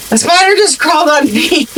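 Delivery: high-pitched retold panic to her husband; panicked. Telling her husband a spider crossed her face, panicked and high. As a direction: blurt it out in alarm, voice up high. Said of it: "'cause I'm panicking".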